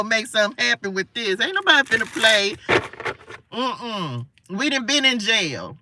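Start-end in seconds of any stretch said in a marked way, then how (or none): none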